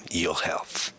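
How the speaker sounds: low, deliberate mother voice with long pauses; mother's letter voice. Retold or imitated. Son reading mother's reasons to quit, low measured voice. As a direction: count off each point low and measured. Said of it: "she was doing the voice like this".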